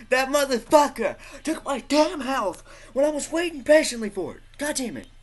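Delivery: high, loud gamer rage; real high-pitched fury; outraged rant. Real, not acted. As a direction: yell indignantly at the thief. Rages at player taking health.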